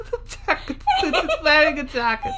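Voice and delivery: high, giggling fake German; helplessly amused silly German; bad German accent. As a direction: lose it laughing in a mock German accent. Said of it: "doing bad German accents at each other".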